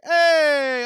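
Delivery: high, loud cheerful hey; doctor's booming hello, mimicked; loud greeting. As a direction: call out a big friendly hey.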